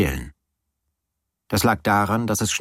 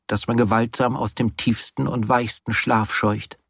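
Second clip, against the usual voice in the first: usual speaking voice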